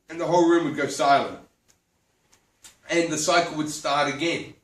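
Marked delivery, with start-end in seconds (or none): none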